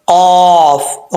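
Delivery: long, rounded "कौफ" with uvular q; full qaf. Shown as a demonstration. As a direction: say "कौफ" heavy and drawn out. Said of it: "तालु के नरम हिस्से लगे".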